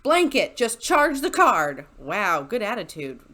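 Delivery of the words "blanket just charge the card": loud, slow, exasperated demand; angry snap. Retold or imitated. Bartender mimics an angry customer slamming down his card, loud and slow. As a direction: bark it out, fed up. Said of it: "slams down his card and goes".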